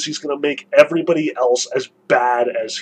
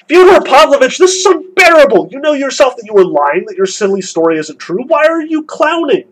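higher and louder, voice shaking with outrage; trembling, losing control; 7 semitones higher, 4 dB louder than usual. A narrator's reading. Miusov losing control over Fyodor's invented story, trembling and loud. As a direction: near the edge, barely holding it together. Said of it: "Miusov said in a trembling voice, losing all control of himself".

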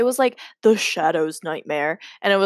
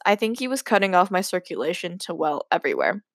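ordinary delivery, nothing marked

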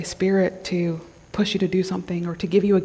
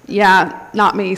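ordinary talk, nothing marked

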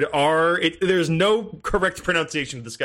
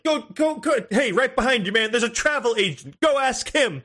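high, excited game character imitation; NPC voice; 7 semitones higher than usual. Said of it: "and he was like".

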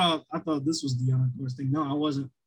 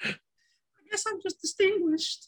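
very high, squeaky joke falsetto; mock chipmunk voice; 4 dB quieter than usual. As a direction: imitate a kid hitting falsetto. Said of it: "already has a chipmunk voice".

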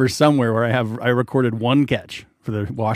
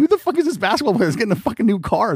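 fast incredulous rant; 10 semitones higher, faster than usual. Retold or imitated. Retold inner thought, fast.

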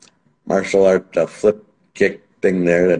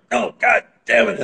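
mock angry outburst; 13 semitones higher than usual. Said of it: "I'm like".

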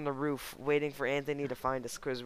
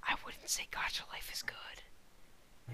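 whispered aside; whispering; 14 dB quieter, much slower than usual. Real, not acted. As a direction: whisper it to the side. Aside under breath.

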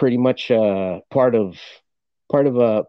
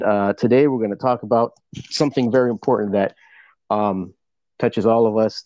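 normal talking voice

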